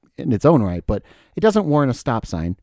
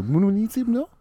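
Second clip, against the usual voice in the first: high, hammy French accent; fake French accent; 12 semitones higher than usual. Shown as a demonstration. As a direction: squeak out a mock French accent. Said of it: "fake French accent".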